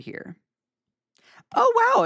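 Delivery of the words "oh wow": high, loud delighted gasp of surprise; surprised wow. Real, not acted. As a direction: react as if the room just filled up. Surprised by a big live audience.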